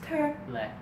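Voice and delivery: high, synthetic navigation prompt; GPS voice. Retold or imitated. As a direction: give directions as a high-pitched satnav. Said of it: "We got the G P S".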